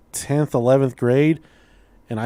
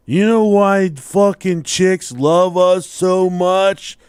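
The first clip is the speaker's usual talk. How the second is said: put-on loud, high, slow drunken demand; drunk brother; 5 semitones higher, 5 dB louder, much slower than usual. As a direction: insist on it like a drunk, every word stretched. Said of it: "he was drunk and fall".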